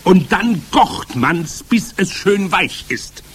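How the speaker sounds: high, slow, loud witch voice; instructing Grand High Witch. A narrator's reading. Witch leader dictating her recipe, commanding.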